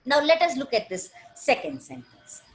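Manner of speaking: ordinary talk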